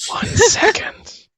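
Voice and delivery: loud, slow stage whisper; whispering corpse. Acted. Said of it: "It'll whisper".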